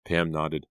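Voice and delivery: ordinary delivery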